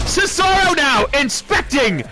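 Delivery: excited wrestling play-by-play with yells on the big moments; live play-by-play. Acted. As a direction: call every move like a hyped ringside announcer. Wrestling game play-by-play commentary.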